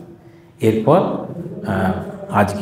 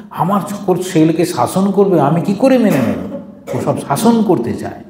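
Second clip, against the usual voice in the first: speaking loudly; 6 semitones higher than usual. Acted. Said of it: "মা তখন খুব জোরে জোরে কথা বলিতেছেন".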